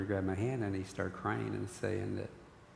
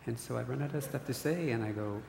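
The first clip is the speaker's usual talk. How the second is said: ordinary talk, nothing marked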